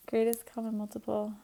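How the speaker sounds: quiet, unsure of the term; doubtful. Real, not acted. Teacher second-guessing herself on the math term, doubtful. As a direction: say it softly, doubting yourself. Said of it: "Do you hear the doubt in my voice".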